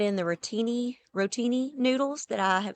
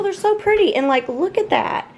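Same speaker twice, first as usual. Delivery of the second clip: gushing; 10 semitones higher, 4 dB louder than usual. Real, not acted. Gushing over new plates.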